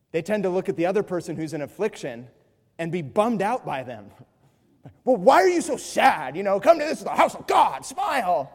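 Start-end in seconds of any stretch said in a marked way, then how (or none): 5.0-8.5 loud, high, hammed-up shout; mock pushy cheer. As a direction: badger the sad one to smile. Mocking joyful person's rebuke.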